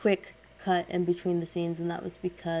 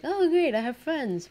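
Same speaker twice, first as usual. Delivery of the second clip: much higher, louder mock delight; mock glee at friends wanting to hang out, high; sarcastic; 9 semitones higher, 5 dB louder, slower than usual. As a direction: fake cheer, really dreading it.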